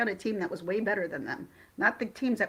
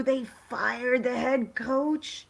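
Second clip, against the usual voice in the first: slow, disbelieving read of the headline; stunned news; 3 semitones higher, much slower than usual. Real, not acted. To viewers after a gasp, reading the coach news in shock. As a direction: let the shock slow every word.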